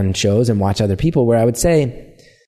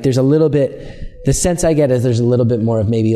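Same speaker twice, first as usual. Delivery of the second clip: ordinary talk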